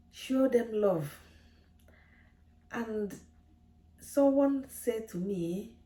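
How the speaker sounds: ordinary talk, nothing marked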